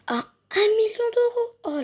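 high, loud amazed cry. Pretend. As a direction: gasp out the price in awe. Amazed at the gift's price.

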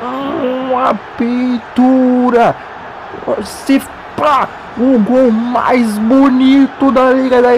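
stretched, high commentator cries; goal celebration shout. Real, not acted. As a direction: savor every word of the goal call. Celebrating the goal just scored. (grito de gol arrastado e empolgado)

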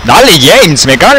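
high, loud yell urging James on; frantic shout. Real, not acted. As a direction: scream at the screen in panic. Panicked fight, yelling at character.

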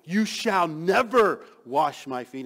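loud, slow, stress on never; emphatic refusal. Acted. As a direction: declare it slowly and loudly, hitting never. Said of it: "But Peter becomes emphatic".

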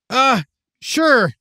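pinched-nose voice; holding nose. Acted. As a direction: speak with your nose held shut. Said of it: "Matt held his nose".

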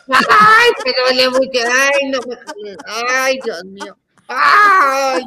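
very high, hammed-up howls; piercing mock cries of agony; screaming in pain. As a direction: wail like a child being hurt. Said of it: "grité, ¿no?".

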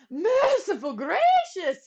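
high, loud shocked gasp of words; old bell ringer shocked at the goo, gulping; gulped. Acted. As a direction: recoil in shock. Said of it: "he gulped".

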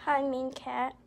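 ordinary delivery

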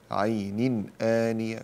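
slow عين آنية with a distinct n before the glottal stop; drawn-out recitation, unblended عين آنية; clear tanween. Shown as a demonstration. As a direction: finish عين on a clean n, then آنية. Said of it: "إظهار تنوين بعد همزة".

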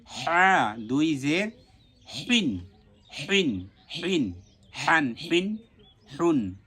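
strong Arabic h from mid-throat in হিন, হ্যান, হুন; deliberate pharyngeal হ on each syllable. Shown as a demonstration. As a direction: drill হিন slowly, breath squeezed through the middle of the throat. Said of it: "এটা কণ্ঠনালীর মাঝখান থেকে উচ্চারণ হবে".

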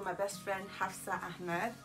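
normal talking voice

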